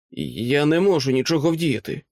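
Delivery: ordinary delivery, nothing marked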